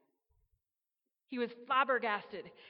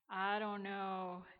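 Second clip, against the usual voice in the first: slow, unsure and halting; hesitant; 2 semitones lower, slower than usual. Acted. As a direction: let the doubt slow you down. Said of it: "somewhat hesitantly".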